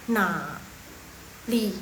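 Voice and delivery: "na, li" with the third tone cut to half. Shown as a demonstration. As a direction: say "na" only halfway through its dip, then "li". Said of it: "Dia bacanya jadi setengah".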